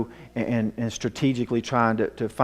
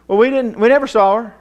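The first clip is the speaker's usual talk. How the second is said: put-on much higher, louder defensive kid voice; boy's denial; 12 semitones higher, 12 dB louder than usual. Son repeating to his mother they never saw her, high loud defensive voice. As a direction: push back like a defensive boy. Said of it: "he goes".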